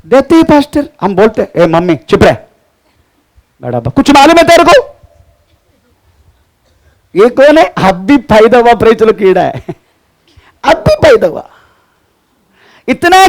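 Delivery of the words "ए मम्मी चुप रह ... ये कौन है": a hint of a cheeky kid shouting mum down; mock a child bossing his mother; rude child. Child mocking mother, acted. (माँ को डाँटते बच्चे की हल्की नकल)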